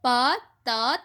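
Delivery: प, त read as separate letters; broken into letters. Shown as a demonstration. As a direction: spell out प, त one by one. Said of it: "इसको पढ़ने का तरीका". (प, त को अलग अलग तोड़कर बोलिए)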